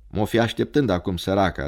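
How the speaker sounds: ordinary delivery, nothing marked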